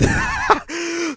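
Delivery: can't hold back a laugh at the name; squealing laugh; high squeaky laugh. Real, not acted. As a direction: burst of high giggling.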